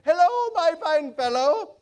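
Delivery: loud hail from a window; calling out. Acted. Scrooge calling down to a boy in Sunday clothes, loud.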